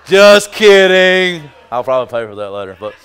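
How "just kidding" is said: loud, slow laughing repeat; laughing loudly. Real, not acted. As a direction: drag it out through a big laugh. Joking about his wife.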